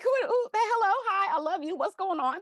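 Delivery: high, loud flustered gushing; starstruck panic. Retold or imitated. Dreaming child greeting [name], high flustered gush. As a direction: babble at your hero, overwhelmed.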